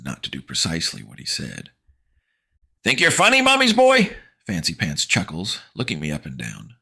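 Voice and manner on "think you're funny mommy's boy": high, loud sneering taunt; taunting bully. Acted. Fancy Pants mocking the narrator, sneering. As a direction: jeer down at him.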